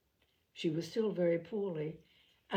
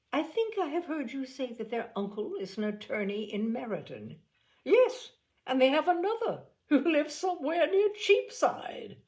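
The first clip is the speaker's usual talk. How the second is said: higher, gleeful snobbish gossip; a snobbish lady voiced higher and louder; sneering sister; 7 semitones higher, 5 dB louder than usual. A narrator's reading. Bingley sisters gossiping about relations.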